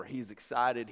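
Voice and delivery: usual speaking voice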